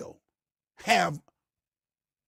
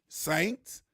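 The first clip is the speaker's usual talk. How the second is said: ordinary talk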